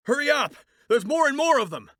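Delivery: high, urgent cries for help; panicked shouting. Acted. Heroine surrounded by monsters, yelling in panic to her partner. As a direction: yell in panic as they close in. Surrounded, calling over the radio.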